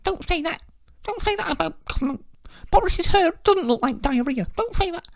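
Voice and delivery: hammed-up telling-off from the authorities; mock government scold. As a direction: wag a finger like a prissy censor. Imagined government scolding the show.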